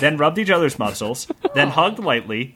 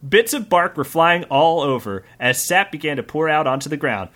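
normal talking voice

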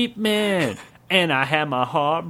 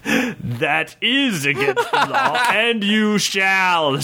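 laughing king voice; 6 semitones higher, slower than usual. Acted. King's verdict, laughing.

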